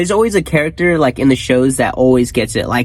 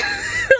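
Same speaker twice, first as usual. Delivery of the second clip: high laugh; 5 dB quieter than usual. Real, not acted. Laughing at diaper story.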